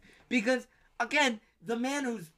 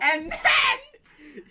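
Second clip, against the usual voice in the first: high squeal of laughter through the words; words swallowed by a laugh; laughing hard; 6 dB louder than usual. Real, not acted. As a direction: crack up while telling it. Laughing mid story.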